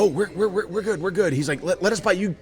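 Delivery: high-pitched stammered peacemaking; mock placating. Buddy offering drinks to avoid a fight, placating. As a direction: imitate a nervous backpedal, tripping over words.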